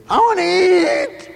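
put-on high, loud drawn-out child's wail; whiny kid. Kids crying out at dinnertime for food. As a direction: whine like a hungry child.

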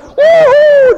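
very high, loud whoop; joyful yell. Acted. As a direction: whoop like kids let loose. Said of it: "yelling and woo-hoo".

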